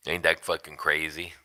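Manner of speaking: usual speaking voice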